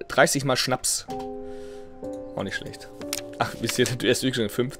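usual speaking voice

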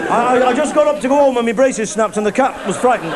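high stammering excuse; panicky excuse. Acted. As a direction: babble it out, caught in the act. Lad stammers excuses to dad.

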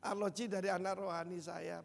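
ordinary talk, nothing marked

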